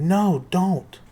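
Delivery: high loud frightened scream. Acted. Annabeth screaming at Percy not to look. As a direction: scream to stop him. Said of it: "Annabeth screamed".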